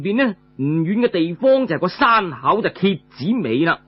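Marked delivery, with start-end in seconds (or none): none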